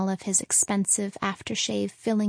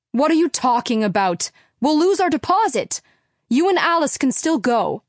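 loud, higher, exasperated shout; father shouting; 5 semitones higher, 7 dB louder than usual. Acted. Father shouting at mother over the lost deposit. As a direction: stop her, losing his temper.

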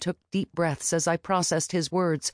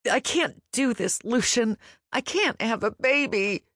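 higher, desperate wail; pleading, pitched up, near tears; 7 semitones higher than usual. A narrator's reading. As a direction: cry out in despair. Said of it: "I wailed".

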